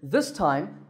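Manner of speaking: normal talking voice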